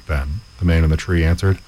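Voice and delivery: usual speaking voice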